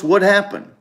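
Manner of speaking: usual speaking voice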